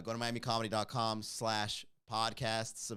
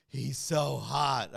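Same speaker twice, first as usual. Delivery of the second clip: swooning fan; 5 dB louder, much slower than usual. Retold or imitated.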